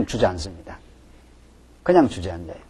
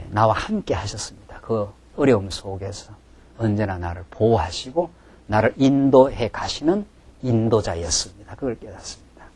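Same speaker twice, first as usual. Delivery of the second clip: hushed testimony; 5 semitones lower, 8 dB quieter than usual. Acted. Quiet prayerful testimony.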